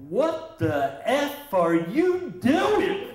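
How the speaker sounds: high, slow, loud furious shout; outraged yell. Retold or imitated. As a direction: yell at him through laughter. Joe yells at barber.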